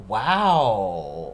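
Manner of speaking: long "Wow" gliding up then falling away; drawn-out wow. Real, not acted. Reactor taking in the music video's ending, drawn-out wow. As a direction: stretch "Wow" out in a slow rise and fall. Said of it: "Why did I say it like that?".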